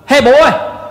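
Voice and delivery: high, loud sharp call; stern yell. Retold or imitated. Neighbor shouting at a boy in the yard, high loud yell. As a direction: holler at a kid caught red-handed. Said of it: "It's more of a".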